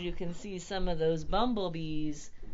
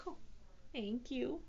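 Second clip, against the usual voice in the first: soft, small, higher thanks; shy; 4 semitones higher, 9 dB quieter than usual. A narrator's reading. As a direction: say it bashfully. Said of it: "said Keith shyly".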